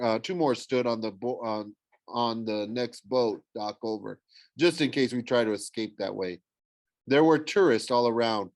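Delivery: ordinary delivery, nothing marked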